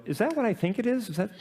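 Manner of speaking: high, amazed question; mock surprise at a hidden treat; surprised parent. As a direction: parent voice lifted high in disbelief. Parents surprised at pina colada.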